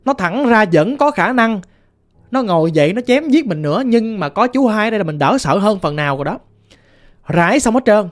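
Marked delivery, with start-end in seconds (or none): none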